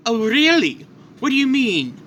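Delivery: high-pitched villain's taunt; mock curiosity. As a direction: toy with the one who is begging. Villain taunts pleading father.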